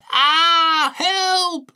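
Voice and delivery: high, loud scream as he falls; falling scream. A narrator's reading. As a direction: shriek for help mid-tumble. Tiger tumbling down, crying out.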